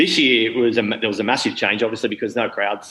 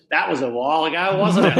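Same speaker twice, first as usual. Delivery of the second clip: smug, teasing jab; 5 semitones higher than usual. Retold or imitated. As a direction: rib him about the old photo. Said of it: "Like this".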